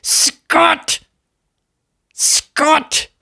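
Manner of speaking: very high-pitched cry of a name; mock exasperated cry. Mimics panel yelling at Scott.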